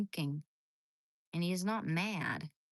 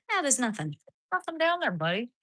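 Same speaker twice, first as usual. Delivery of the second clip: higher, louder coo; high pet talk; 6 semitones higher, 6 dB louder, faster than usual. Real, not acted. Woman reassuring her dog in high coo.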